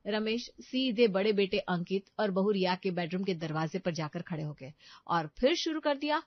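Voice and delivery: ordinary delivery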